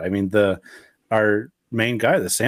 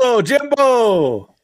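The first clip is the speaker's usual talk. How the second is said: hype call; 13 semitones higher, 5 dB louder, much slower than usual. Acted. Repeats intro catchphrase.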